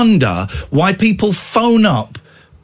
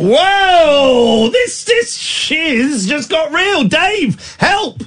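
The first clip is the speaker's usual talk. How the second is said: high wailing fake alarm, much higher; mock panic; 10 semitones higher, much slower than usual. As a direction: cry out in hammed-up panic. Host mock panics at kidnapper joke.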